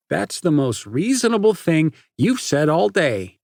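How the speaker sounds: loud, high mocking jeer; jeering at Parker. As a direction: sneer it out. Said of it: "Nate jeered".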